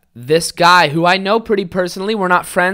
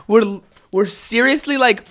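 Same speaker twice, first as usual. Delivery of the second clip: ordinary talk, nothing marked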